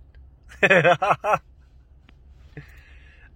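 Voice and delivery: sudden high, loud laugh bursting out; loud burst of laughter. Real, not acted. Vlogger laughing at himself on camera, loud burst of laughter. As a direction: crack up at your own swearing.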